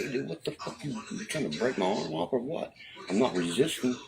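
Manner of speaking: high-pitched, indignant retold objection; alarmed protest, replayed for listeners. Acting out protest during a rough arrest.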